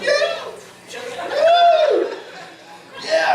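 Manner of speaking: high whooping cheer; wild celebration. Retold or imitated. Dad celebrating in end zone.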